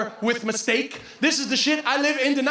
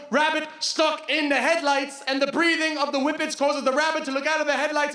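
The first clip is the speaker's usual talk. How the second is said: usual speaking voice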